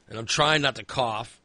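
ordinary talk

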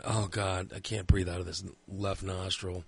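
low, soft retold self-talk; anxious inner voice. Man lying awake with blocked nose, quiet fretting. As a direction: worry quietly to yourself. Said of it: "this is all going through my head".

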